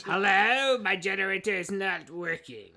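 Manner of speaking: loud villain on a complaint call; hammed-up villain complaint; Cobra Commander. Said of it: "Cobra Commander".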